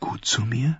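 barely breathed words; whisper. A narrator's reading. Sansa admits to her aunt softly, whispering. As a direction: let it slip out in a whisper.

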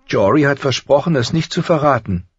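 put-on high girl's voice, caught out; guilty girl. A narrator's reading. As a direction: daughter defending herself, embarrassed. (ertappt, hohe Mädchenstimme)

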